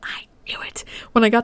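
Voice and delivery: excited whisper, fast. Real, not acted. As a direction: hiss the secret out in a rush. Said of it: "that was probably really loud".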